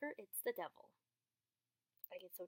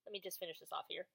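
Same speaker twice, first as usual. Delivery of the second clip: usual speaking voice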